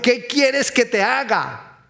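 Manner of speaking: high, slow, ringing question; emphatic question. Acted. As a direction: declaim it slowly to a congregation. Reads Jesus's question emphatically.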